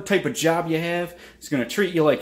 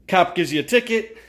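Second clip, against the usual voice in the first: ordinary delivery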